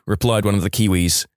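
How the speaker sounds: ordinary talk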